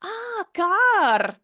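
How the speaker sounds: imitating students finally getting it; sudden lightbulb moment, retold; dawning realization. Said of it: "they were like".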